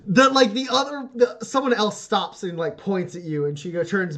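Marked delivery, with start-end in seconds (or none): none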